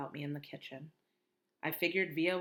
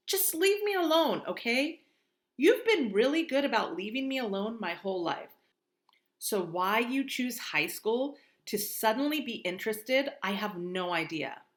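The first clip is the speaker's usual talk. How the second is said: put-on angry teen; 5 semitones higher, 7 dB louder than usual. Via yelling at Mom.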